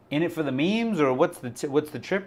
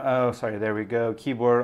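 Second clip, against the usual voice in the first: normal talking voice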